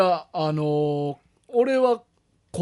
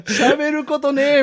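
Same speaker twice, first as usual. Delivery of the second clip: high, loud, dragged-out mock lament; troubled complaint; 7 semitones higher, 5 dB louder, slower than usual. Cohost playing a listener with nothing to talk about, mock-troubled wail. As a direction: wail it slowly in pretend despair. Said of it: "困ってる会みたいな".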